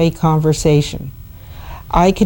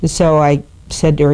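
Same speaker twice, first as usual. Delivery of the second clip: usual speaking voice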